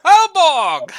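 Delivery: loud joyful hail across the room; shouting. Acted. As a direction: call his name out loud. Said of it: "Marius shouted".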